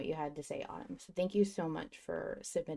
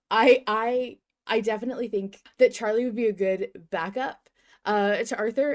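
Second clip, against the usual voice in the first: louder, eager gushing; bursting to say it; excited; 4 semitones higher, 7 dB louder than usual. Real, not acted. Host gushing over a listener theory, excited.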